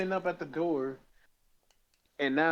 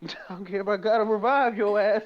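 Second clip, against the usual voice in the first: higher, louder pushy landlord voice; cold greedy landlord; 6 semitones higher, 4 dB louder than usual. Acted. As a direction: demand the rent like a greedy landlord. Landlord demanding rent from cat.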